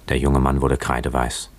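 ordinary delivery

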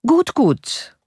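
high, lightly voiced scornful officer; mocking tone. A narrator's reading. Captain mocking the retreating citizens, scornful and high. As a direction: toss it off with a sneer. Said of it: "mit jenem spöttischen Ton".

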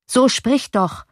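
slow, impatient urging. A narrator's reading. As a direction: press him to speak up. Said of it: "forderte der Ratspensionär ihn auf".

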